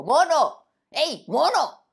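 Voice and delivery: loud high call across the jungle. Acted. Lion to the monkey, loud high calling.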